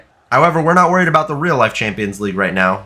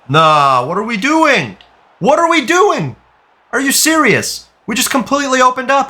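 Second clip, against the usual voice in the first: pitched way up, loud and cracking with outrage; furious disbelief; 12 semitones higher, 3 dB louder than usual. Real, not acted. Raging at his team after conceding again, high furious yelling. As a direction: scream it at the screen like your team just gave up a goal.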